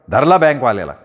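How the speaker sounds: usual speaking voice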